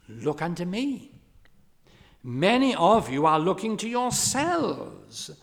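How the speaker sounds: retold pulpit appeal with punched key words; lay preacher. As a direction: voice a preacher urging his congregation. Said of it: "he said to the congregation".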